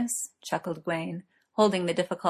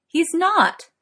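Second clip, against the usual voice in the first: loud protest; 11 semitones higher, 7 dB louder than usual. Acted. Merlin protests loudly.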